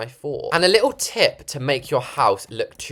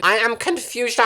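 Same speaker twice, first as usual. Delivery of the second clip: higher meme voice, playing dumb; mock confusion; 10 semitones higher than usual. Builder mocks her own confusion in a meme voice.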